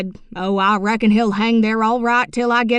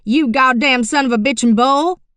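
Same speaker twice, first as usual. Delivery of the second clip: louder, higher angry yell at the bull; old man cursing the steer, loud and higher; 6 semitones higher, 10 dB louder than usual. Acted. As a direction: bellow it at the stubborn steer. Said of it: "McCann yelled real loud".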